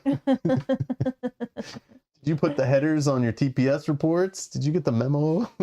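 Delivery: a hint of a nagging boss; pretend boss. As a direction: play at being the boss checking up. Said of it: "Mike's the boss".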